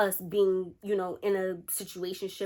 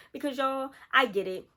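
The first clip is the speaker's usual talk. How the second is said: usual speaking voice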